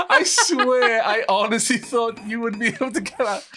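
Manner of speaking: high, loud words through real laughter; helpless laughter. Real, not acted. Builder excuses leaving a friend trapped, laughing helplessly. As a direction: protest while cracking up.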